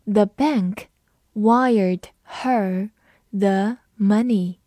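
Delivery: word by word with pauses, slowly; slow teacher's model reading for repeating; slow read-along. Shown as a demonstration. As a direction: stretch it out, pausing between phrases. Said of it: "跟我慢读一遍".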